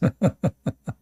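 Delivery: real amused giggle; teasing laugh. Real, not acted. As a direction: chuckle at your own teasing. Teasing women about giving tickets.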